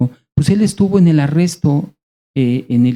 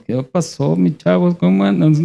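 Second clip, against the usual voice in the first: Galilean Jesus, northern tone; 4 semitones higher than usual. Retold or imitated. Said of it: "Yo me imagino a Jesús".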